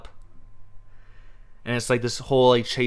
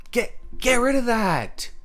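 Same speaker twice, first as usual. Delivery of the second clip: exasperated outburst; 11 semitones higher than usual. Real, not acted. Ranting about the Cuba scene.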